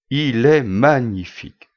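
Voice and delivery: slow, drawn-out marvelling exclamation; exclaiming admiration. Acted. Customer admiring the object shown at the till, exclaiming. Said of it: "s'exclama-il".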